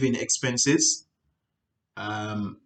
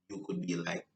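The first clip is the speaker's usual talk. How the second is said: ordinary talk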